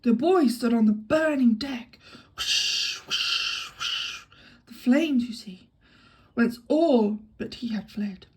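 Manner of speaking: slow, loud mock-dramatic recital; hammed-up recitation. Shown as a demonstration. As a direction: act the verse out with gusto. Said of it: "I can do the bit with actions".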